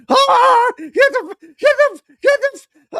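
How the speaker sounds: high, loud panicked cry, stumbling over words; panicked yelp. Retold or imitated. Golfer on the ground, imitated in a high panicked yell. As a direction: scramble to get the words out in a frightened yell.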